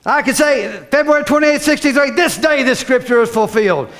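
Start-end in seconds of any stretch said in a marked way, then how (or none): none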